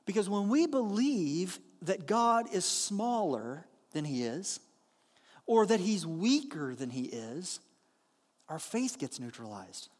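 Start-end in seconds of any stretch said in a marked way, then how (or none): none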